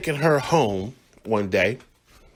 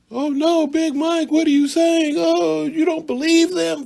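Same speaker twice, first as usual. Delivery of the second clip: mock high voice. Mocking an imagined doubter in a high falsetto. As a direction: squeal it like a shocked skeptic.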